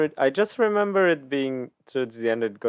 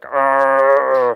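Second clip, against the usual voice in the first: power-up yell; 7 dB louder than usual. Retold or imitated. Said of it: "It's like".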